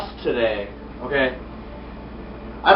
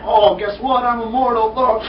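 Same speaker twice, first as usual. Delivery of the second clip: higher, hammed-up bragging; mock boast; 5 semitones higher than usual. Character boasts he's immortal in a retold scene, mock boast. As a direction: crow it like a cartoon immortal.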